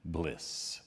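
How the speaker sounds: soft, savoring hush; blissful sigh. A narrator's reading. Recalling grandmother's cocoa, hushed and blissful. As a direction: let the word melt like warm cocoa.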